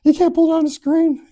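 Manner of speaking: high, loud, silly whine; mock your own dumb self, up high; squeaky self-mockery; mocking idiot voice.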